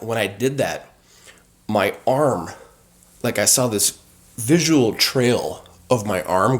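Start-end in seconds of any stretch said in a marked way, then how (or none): none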